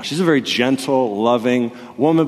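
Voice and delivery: ordinary delivery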